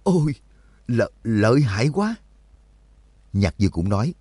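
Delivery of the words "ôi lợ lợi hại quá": higher-pitched, stumbling exclamation of awe; amazed outburst. A narrator's reading. Onlooker seeing the monk make the leap, blurting in amazement. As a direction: blurt it out in astonishment. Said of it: "kinh ngạc đến nỗi không ghim nổi, buột miệng thốt lên".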